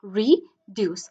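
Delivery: "Reduce" in two separate beats, re-duce; "reduce" pulled apart into two syllables. Shown as a demonstration. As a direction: pause between the syllables of "reduce". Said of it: "দুইটা syllable এখানের মধ্যে আছে".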